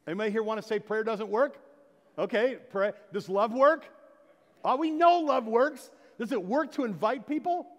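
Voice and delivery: preaching at full force. Acted. Pastor questioning his church, preaching at full force. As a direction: rouse the congregation with each question.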